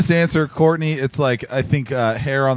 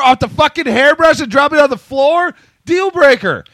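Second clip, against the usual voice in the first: outraged shout; 11 semitones higher, 5 dB louder than usual. Real, not acted. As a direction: shout it, high and loud. Outraged at girlfriend's habits.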